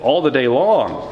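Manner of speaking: lamenting scripture reading. Acted. Pastor reads Jeremiah's private lament to the Lord, impassioned. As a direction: pour out the complaint as you read.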